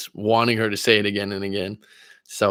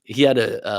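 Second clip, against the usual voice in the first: ordinary delivery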